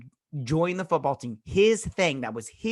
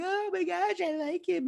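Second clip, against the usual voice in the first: very high, put-on squealy voice; mock teen gushing. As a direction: imitate smitten girls squealing. Mocking gushing teens on TV.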